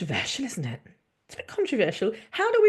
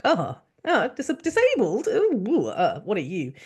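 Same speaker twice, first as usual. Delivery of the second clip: mock horror; 3 semitones higher, 3 dB louder than usual. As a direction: recoil in exaggerated disbelief.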